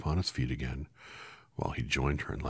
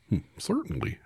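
higher, louder straw-man voice; a friendly scarecrow greeting, pitched up; 5 dB louder than usual. Acted. Scarecrow greets Dorothy from his pole, pitched up.

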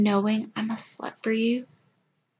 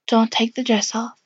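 ordinary delivery

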